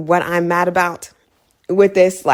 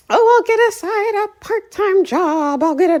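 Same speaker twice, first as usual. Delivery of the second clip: mocking falsetto; 13 semitones higher than usual. Mocking a relative's promise to get a part-time job, high singsong. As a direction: put it way up high to mock the empty promise.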